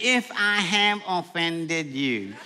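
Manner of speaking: slow, soft, insincere apology with a laugh; mock weaselly apology; mocking half apology. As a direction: drag out the fake sorry. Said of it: "Don't give no half apologies".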